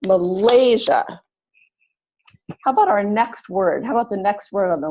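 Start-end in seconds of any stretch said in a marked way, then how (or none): 0.1-1.0 "Malaysia" with LAY long and loud, the rest short; stress on second syllable. Shown as a demonstration. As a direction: demonstrate ma-LAY-sia for "Malaysia". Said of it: "Second syllable is longer and louder".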